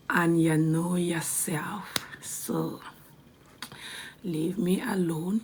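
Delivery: slow, unvoiced whisper; weary whisper. Real, not acted. A slow whisper telling her haters to leave her alone. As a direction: say it slowly under your breath, done with them.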